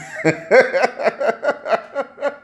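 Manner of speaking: high, long giggling run; high-pitched sustained laughter; real laughing fit. Real, not acted. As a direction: crack up helplessly at your own joke. Cracks up at his own joke.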